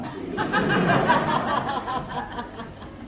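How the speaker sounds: high breathy laugh. Real, not acted. Pastor laughing at the crying boy's promise, high laughter.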